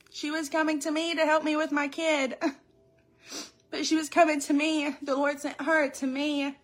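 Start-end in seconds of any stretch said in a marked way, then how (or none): none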